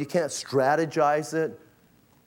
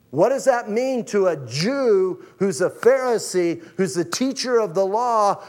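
higher, louder, driving preacher voice; preaching; 6 semitones higher, 3 dB louder than usual. Acted. Preacher pressing Jesus' claim on the crowd, higher and louder. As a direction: press the point home to the congregation.

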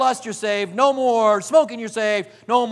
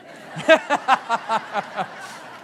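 breathy laugh. Real, not acted. Laugh after the joke.

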